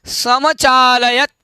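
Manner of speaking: समचालयत said slowly, with a long चा; careful pronunciation. Shown as a demonstration. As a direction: model समचालयत in drawn-out syllables. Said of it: "एक बार उच्चारण कर लेंगे".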